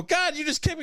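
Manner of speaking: a lightly voiced hurt whine; mock pained protest. Son complaining to dad after the kick, high and whiny. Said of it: "I get up and go".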